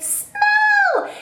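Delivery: very high gleeful one-word command; excited squeal. Retold or imitated. Wishing viewers could smell her candle, squealing excitedly. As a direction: squeal it, urging them to sniff. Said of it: "I just wanna be like".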